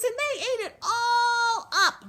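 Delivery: put-on wailing baby bear, loud and drawn out; high squeaky voice. A narrator's reading. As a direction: squeal it out, stretching to a wail. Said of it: "in her high squeaky voice".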